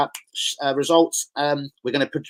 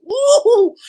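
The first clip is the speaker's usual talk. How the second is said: very high drawn-out woohoo; squealed, stretched-out cheer; celebratory whoop; 6 dB louder than usual. Acted. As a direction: whoop it in falsetto. Mock celebration of Arsenal winning the title.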